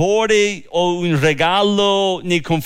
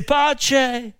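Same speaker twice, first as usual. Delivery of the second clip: high-pitched call, not really shouted; mild retold cry; 8 semitones higher than usual. Runner greets the king with news of peace, a mild retold cry. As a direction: call it out high at ordinary volume. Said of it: "Ahimaaz gridò al re e disse".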